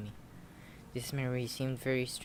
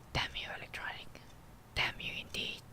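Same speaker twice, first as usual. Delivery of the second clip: hushed, voiceless cursing; whispered curse; 6 dB quieter than usual. Acted. Player cursing Electronic for interrupting, whispered. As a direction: curse under your breath in a whisper. Cursing the intruder under breath.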